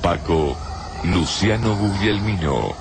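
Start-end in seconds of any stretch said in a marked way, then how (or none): none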